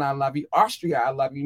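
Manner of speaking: normal talking voice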